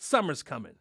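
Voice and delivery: usual speaking voice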